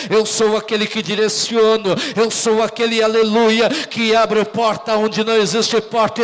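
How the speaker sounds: loud, rapid-fire declarations; full-force preaching. Real, not acted. Preacher voices God claiming authority, in a loud rapid build. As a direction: pile up the phrases fast and forceful.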